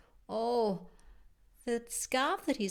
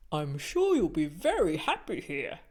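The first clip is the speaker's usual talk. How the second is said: lower, louder teacher voice; Mister Winko; 5 semitones lower, 6 dB louder than usual. Acted. Teacher Mister Winko welcoming Al, low and loud. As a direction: boom it like a kindly old teacher. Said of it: "said Mister Winko".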